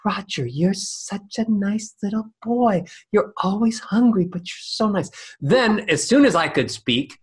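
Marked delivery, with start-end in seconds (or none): 0.0-5.1 high, breathy, sweet mother; airy mother voice. Retold or imitated. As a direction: coo it in an airy voice. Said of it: "if she sounded really airy".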